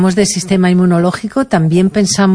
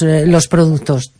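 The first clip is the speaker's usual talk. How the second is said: usual speaking voice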